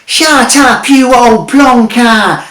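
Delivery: high, loud brash wizard full of bluster; a cocky market trader of a wizard selling junk. A narrator's reading. Wizard mocking Jeremy and selling him a sat-nav, brash and loud.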